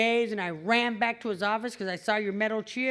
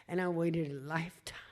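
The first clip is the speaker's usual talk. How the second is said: hushed; 5 semitones lower, 5 dB quieter than usual. Real, not acted. Recalling long wait, hushed.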